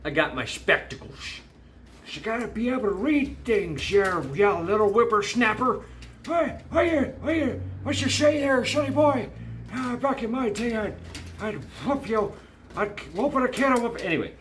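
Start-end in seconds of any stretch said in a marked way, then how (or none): 2.1-14.0 put-on high, cranky old codger voice; grumpy old timer scolding a whippersnapper; old prospector. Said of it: "since I'm an old man".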